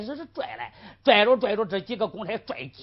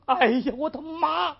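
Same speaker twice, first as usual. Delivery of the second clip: 惨叫; 8 semitones higher, 4 dB louder than usual. Acted. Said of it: "徐文彪大叫一声".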